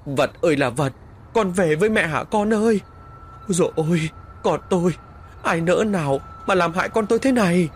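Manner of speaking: high, loud wailing cry for her son; keen and wail over the lost child; wailing lament. Acted. Mother wails at her dead son's coffin, loud lament. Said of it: "khóc bù lu bù loa".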